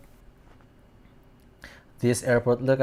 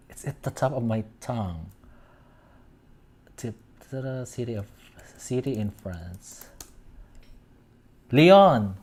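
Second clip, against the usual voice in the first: usual speaking voice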